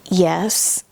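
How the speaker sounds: imitating a reluctant, low yes. Bishop cornered by his wife's question, reluctant. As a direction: admit it unwillingly. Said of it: "he just didn't want to say that".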